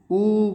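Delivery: "ऊ" held long, like ooo; drawn-out long "ऊ"; long vowel demonstration. Shown as a demonstration. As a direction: stretch "ऊ" out before carrying on. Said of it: "बड़ा होगा, देर तक होगा".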